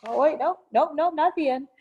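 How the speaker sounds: plain reading voice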